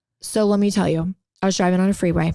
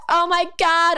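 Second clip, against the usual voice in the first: higher-pitched panicked exclamation, re-enacted; retold freak-out; 6 semitones higher than usual. Driver finds roach on her leg, retold freak-out. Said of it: "I freak out".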